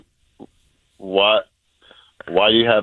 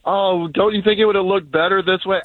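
lightly voiced nagging wife, pitched up; higher-pitched mock complaint; complaining wife; 7 semitones higher than usual. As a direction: imitate your wife's complaint in a higher voice. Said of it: "all Sharon was gonna do is complain about".

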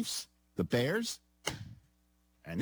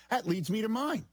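segue pushed very high; higher register; 8 semitones higher than usual. Pretend. Host repeats his lead-in, in a higher register. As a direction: take the voice right up high. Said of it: "still gotta go high register".

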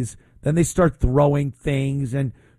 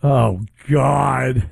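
ordinary delivery

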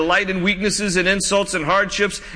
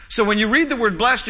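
ordinary talk

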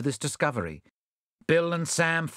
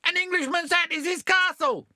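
very high shrill cry; shouted protest; 4 dB louder than usual. A narrator's reading. Unmasked thieves cry out as they are overpowered, very high. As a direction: squeal it in outrage. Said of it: "who shouted".

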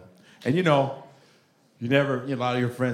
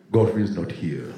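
lower, deep regal Nigerian father; a hint of Mufasa; Lion King father; 8 semitones lower than usual. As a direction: answer the phone like a lion king. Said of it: "talking to the Lion King".

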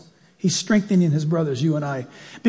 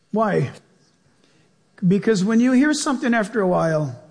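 normal talking voice

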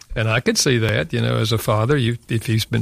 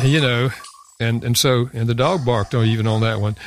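ordinary talk, nothing marked

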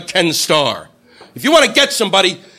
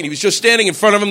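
ordinary delivery

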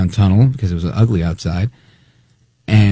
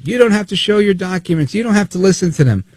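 raised, higher imitation of a man yelling; higher, pushy mock yell; 9 semitones higher than usual. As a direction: bark it like the heckler at the cops. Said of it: "And he's yelling at them".

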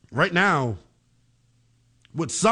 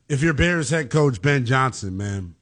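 ordinary delivery

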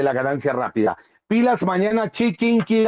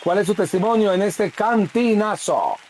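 higher, sing-song showman greeting; radio announcer; 4 semitones higher than usual. Acted. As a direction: hype the caller in like a pitchman. Host presents caller's testimony.

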